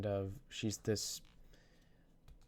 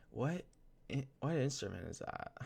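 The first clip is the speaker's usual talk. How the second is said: higher, halting question; imitated nervousness; 4 semitones higher, 4 dB quieter than usual. Student imitating a girl walking up to ask, nervous and halting. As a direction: stumble and restart, imitating a nervous asker. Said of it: "She was kinda nervous".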